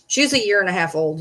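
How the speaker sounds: ordinary talk, nothing marked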